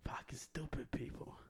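hushed unvoiced whisper; a muttered whisper of contempt. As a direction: whisper it under your breath. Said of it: "I go like this".

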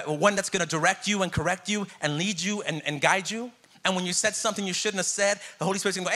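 usual speaking voice

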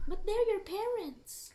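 mock preachy parent. Mocking the parental cliché.

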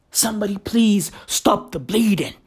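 ordinary delivery

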